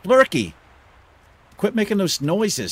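high, loud startled shout; startled yell. Real, not acted. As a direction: jump and yell at it.

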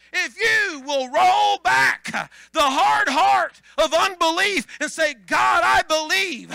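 loud, high preaching shout. Acted. Pastor urging the congregation to believe, full preaching shout.

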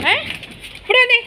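hoog en luid roepen naar het dier; calling out. Real, not acted. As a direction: roep hem scherp terug. Calling the pet back.

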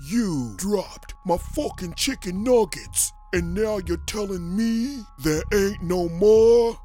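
furious threat to shoot; angry mafia threat. Acted. Mobster shouting threats at the manager over nuggets. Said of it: "he said angrily".

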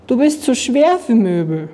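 mild reproach, stress on schwer; lightly indignant statement leaning on schwer; scolding. A narrator's reading. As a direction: tell off the monster, stressing schwer. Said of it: "schimpfte Anna".

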